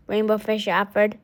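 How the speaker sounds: ordinary delivery, nothing marked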